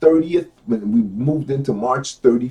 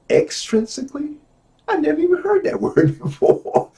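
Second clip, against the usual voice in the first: high-pitched, laughing disbelief; amused, puzzled high voice; mock bafflement; 13 semitones higher than usual. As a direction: query "extrinsically" like a word never heard. Voices baffled reaction to big word.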